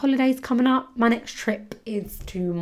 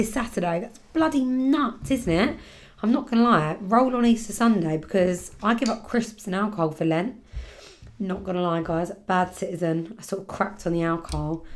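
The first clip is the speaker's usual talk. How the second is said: ordinary talk, nothing marked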